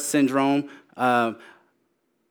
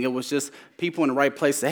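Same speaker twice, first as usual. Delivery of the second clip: ordinary delivery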